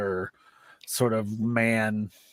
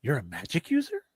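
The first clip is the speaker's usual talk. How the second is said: somewhat higher-pitched big man, curious; 6 semitones higher, faster than usual. Acted. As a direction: lift the voice into a curious question. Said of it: "There's this really high-pitched voice".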